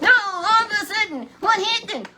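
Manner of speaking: high, loud breathless build-up; excited build. Real, not acted. Preacher tells of the sudden chariot, high and loud. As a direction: burst out high and loud with suspense.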